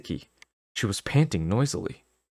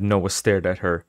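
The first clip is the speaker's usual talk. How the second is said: ordinary delivery, nothing marked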